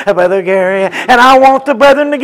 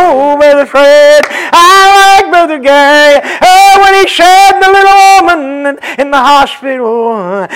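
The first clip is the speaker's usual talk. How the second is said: raised, sung-out preaching chant; whooped preaching; 4 semitones higher, 5 dB louder than usual. Acted. Preacher recounting the hospital visit to the church, whooped. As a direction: carry the story on a tuneful whoop.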